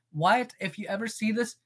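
ordinary delivery

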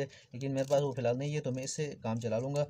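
usual speaking voice

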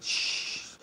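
a quick hushing whisper; shushing whisper. Retold or imitated. Irish people hushing, quoted.

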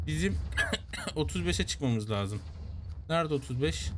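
ordinary talk, nothing marked

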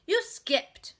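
high, loud accusation; interrupting child. A narrator's reading. Little sister catches a skip, interrupting. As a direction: cut in like an indignant child. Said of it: "interrupted Ramona".

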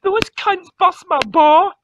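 ordinary talk

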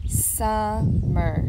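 "Summer" slowly as Sum, mer; split into syllables. Shown as a demonstration. Teacher helps preschoolers hear the parts, "Summer" split in two. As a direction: break "Summer" in two, a gap between syllables. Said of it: "how many syllables do you hear".

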